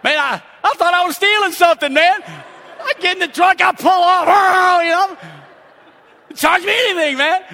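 loud, high storytelling shout; exhilarated outburst. Retold or imitated. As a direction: holler it like a gleeful getaway. Retelling his getaway, elated.

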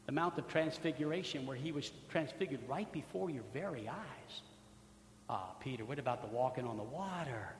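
soft, low, intimate pleading; hushed preaching. Acted. Preacher softly reproaching Peter before the congregation, hushed and low. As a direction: draw the listeners close with a hushed reproach.

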